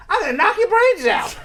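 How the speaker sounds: high mock kid's yell; a hammed-up child threat; angry little boy. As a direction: shout it like a furious nine-year-old. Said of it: "marched purposefully up ... and shout".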